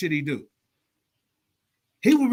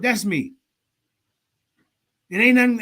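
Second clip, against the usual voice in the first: normal talking voice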